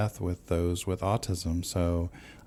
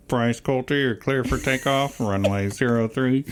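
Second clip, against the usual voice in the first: air traffic controller; 5 semitones higher than usual. Retold or imitated. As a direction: call it like a tower radio. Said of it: "you're going".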